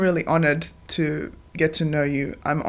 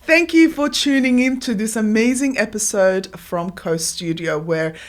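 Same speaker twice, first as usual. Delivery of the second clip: bright, higher presenter sign-off; announcer outro; 3 semitones higher, 4 dB louder than usual. Acted. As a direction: wrap up the show like a host.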